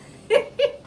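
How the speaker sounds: short high squeal of laughter; laughing. Real, not acted. Laughs after trust me.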